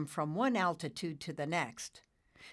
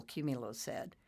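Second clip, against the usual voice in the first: plain reading voice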